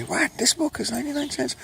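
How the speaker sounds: amazed high voice. Pretend. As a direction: gasp it out in shock at the price. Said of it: "people will feel guilty".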